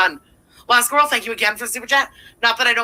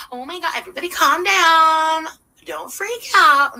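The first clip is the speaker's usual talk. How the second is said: exaggerated, higher-pitched mocking plea; mock panic-calming; 6 semitones higher than usual. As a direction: imitate someone needlessly calming a crowd. Mocks streamer calming imagined panic.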